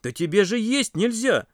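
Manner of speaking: high-pitched astonished objection; surprised. A narrator's reading. Girls hear the patient ask for food, surprised. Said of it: "удивились девчонки".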